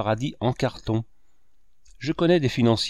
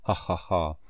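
mocking laugh; 5 semitones lower, 4 dB quieter than usual. A narrator's reading. Fauvarc laughs at his joke.

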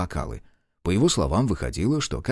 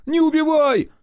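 high, loud begging cry; the beaten son begs his father to stop; panicked wail; 5 dB louder than usual. Acted. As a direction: beg for your life. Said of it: "завопил молодчик".

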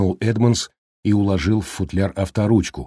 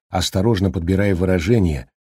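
ordinary delivery, nothing marked

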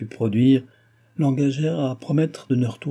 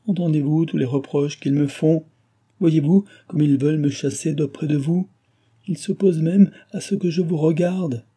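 higher-pitched pleading questions; exclaimed appeal; 6 semitones higher, 3 dB louder than usual. A narrator's reading. Henriette telling her sick friend they chase her away, pleading cry. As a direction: complain to her, voice lifted in mild distress. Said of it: "s'écria Henriette".

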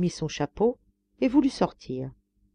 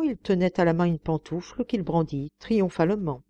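ordinary delivery

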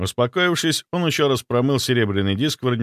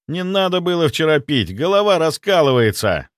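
higher-pitched morning-after grumble; complaining; 6 semitones higher, 3 dB louder than usual. A narrator's reading. Hungover friend at breakfast, complaining in a raised pitch. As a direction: complain about a splitting head. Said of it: "Пожаловался он за завтраком".

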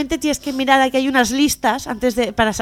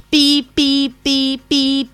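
car horn beeps; much slower than usual. Retold or imitated. Mercedes horn honking.

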